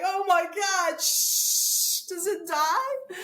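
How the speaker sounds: high, frantic squeal with a spray hiss; panicked spraying. Retold or imitated. Traveler spraying a running spider, high panicky squeal.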